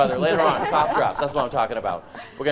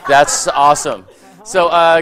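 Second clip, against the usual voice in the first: normal talking voice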